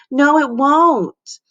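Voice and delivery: slow, high moan. A narrator's reading. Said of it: "moaned Missy".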